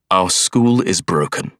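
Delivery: ordinary delivery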